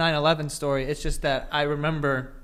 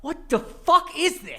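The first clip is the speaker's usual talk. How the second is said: much higher, shocked outburst; incredulous teen; 12 semitones higher than usual. Retold or imitated. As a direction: blurt it out in disbelief. Retold teen reaction.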